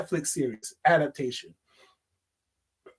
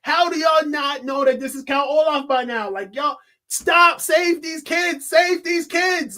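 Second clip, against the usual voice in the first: exasperated outburst; 6 semitones higher, 4 dB louder than usual. Retold or imitated. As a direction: beg the characters to see the obvious. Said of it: "I remember reading the books and I was like".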